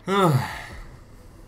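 exasperated sigh, pitch up then falling; irritated huff. Real, not acted. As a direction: let out a fed-up huff. After losing to trash talkers.

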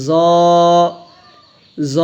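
"জ" with a heavy z and long rounded o; thick sound. Shown as a demonstration. Teacher showing the thick letter, heavy long "জ". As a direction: make "জ" thick and full, drawn out. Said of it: "মোটা আওয়াজ হবে".

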